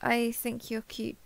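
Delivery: soft and tentative; hesitant, bashful return of a compliment; shy, flustered thanks. Acted.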